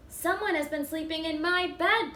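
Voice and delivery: mild bellow. Acted. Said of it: "Papa Bear bellowed".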